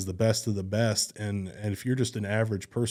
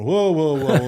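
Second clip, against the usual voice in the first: loud, raised "hold on" reaction; retold balking; 5 semitones higher, 8 dB louder, slower than usual. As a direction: act out putting the brakes on, louder. Acts out balking at scammer's request.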